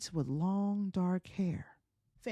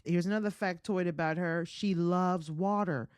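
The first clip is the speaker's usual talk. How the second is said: ordinary delivery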